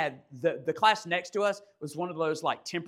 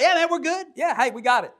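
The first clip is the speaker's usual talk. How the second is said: high, loud nervous yes-yes; two guys caught wrestling, loud and flustered; flustered excitement; 10 semitones higher, 9 dB louder, faster than usual. Retold or imitated. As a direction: caught red-handed, rushing to reassure. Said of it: "me and my buddy jumped up like".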